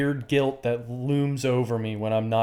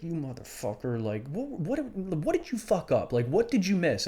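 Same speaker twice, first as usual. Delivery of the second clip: self-blame; 5 semitones higher, 5 dB quieter than usual. Retold or imitated. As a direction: scold yourself, quiet then rising up. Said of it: "I was like".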